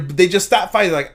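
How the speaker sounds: ordinary talk, nothing marked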